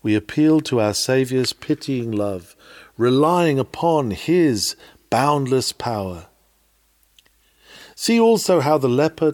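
ordinary delivery

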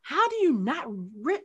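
high, rising disbelief; real incredulity. Real, not acted. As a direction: demand it, stunned they missed it. Disbelief at friend not recognizing actress.

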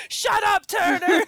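loud retold yell at a bandmate; hammed-up shout; mock shout. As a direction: bark it like an annoyed bandmate. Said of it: "being like".

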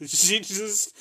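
ordinary talk

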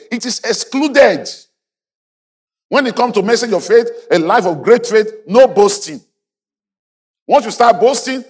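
high-pitched pulpit declaration; emphatic preaching. Real, not acted. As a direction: drive each point home high and firm. Preaches at full force.

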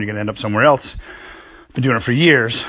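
ordinary talk, nothing marked

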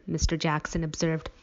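ordinary delivery

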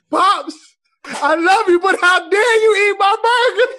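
high, loud wail of betrayal; tearful mock outrage. Son confronting his dad over his eaten burger, tearful mock outrage.

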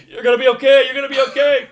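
very loud, high frantic pep talk; panicked self-reassurance. Retold or imitated. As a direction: yell it at yourself in the mirror. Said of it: "looking in the mirror going".

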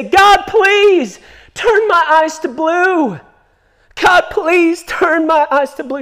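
put-on high, slow pleading child voice; little girl praying. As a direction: plead like a small girl at her bedside.

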